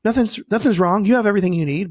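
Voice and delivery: dismissive mom. Retold or imitated. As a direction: lightly mimic a mother waving the worry away. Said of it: "And the mom's like".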